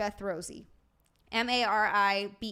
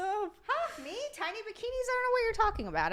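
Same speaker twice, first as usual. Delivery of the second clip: much higher, feigned innocent denial; mock innocence; 10 semitones higher than usual. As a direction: play dumb, high and coy. Teased about thong bikinis.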